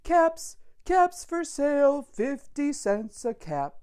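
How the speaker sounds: high, loud street cry, easing down at the end; storybook peddler hawking his caps; peddler calling out wares. Acted. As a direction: call out to the whole street to sell caps. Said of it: "he called".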